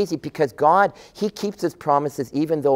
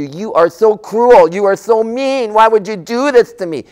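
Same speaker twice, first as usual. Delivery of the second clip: put-on whiny kid; 6 semitones higher, 5 dB louder than usual. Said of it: "they could say to you".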